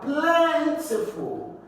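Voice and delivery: plentiful with PLEN high and long; stress on first syllable. Shown as a demonstration. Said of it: "stress on the first syllable".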